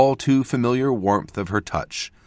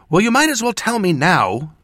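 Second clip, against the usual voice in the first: high, loud huffy demand; indignant, thrown out in a temper; storming off; 12 semitones higher, 7 dB louder than usual. A narrator's reading. Apprentice storming ahead of mentor, angry. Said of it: "as she stormed down the road".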